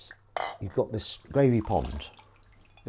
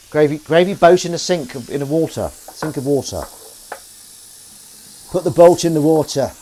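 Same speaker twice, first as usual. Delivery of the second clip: loud, gruff northern actor sample; booming audition-style Sean Bean line; 6 semitones higher, 10 dB louder than usual. Acted. Sampling a heightened Sean Bean voice for viewers. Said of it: "that's my kind of Sean Bean voice".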